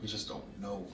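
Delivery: slow, soft, fighting back tears; choked up. Real, not acted. Son to his father, choked up and slow. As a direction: hold back the emotion, barely getting it out. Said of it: "I don't wanna get emotional".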